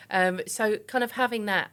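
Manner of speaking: usual speaking voice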